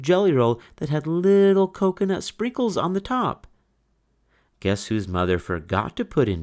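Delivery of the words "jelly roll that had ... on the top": envious high voice. A narrator's reading. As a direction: rise up high with jealousy. Envious about dessert.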